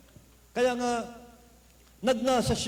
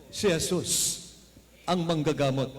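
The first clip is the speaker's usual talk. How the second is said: usual speaking voice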